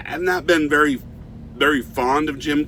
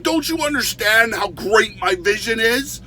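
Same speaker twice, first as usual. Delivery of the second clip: mock screaming rant; 9 semitones higher than usual. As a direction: rant like a spoiled artist nobody appreciates. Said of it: "this guy starts screaming out".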